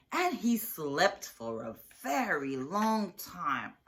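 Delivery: ordinary delivery, nothing marked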